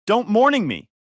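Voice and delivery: high, loud indignant retort; indignant snap. Acted. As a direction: bite back, offended, cutting him off. Furious mother cuts him off.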